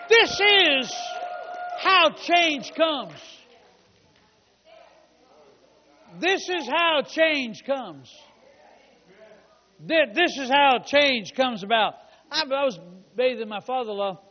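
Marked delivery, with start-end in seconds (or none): 0.1-12.0 loud, drawn-out proclamation, easing off slightly; emphatic preaching. Real, not acted. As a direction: drive the point home to the congregation. Preacher drives the point home.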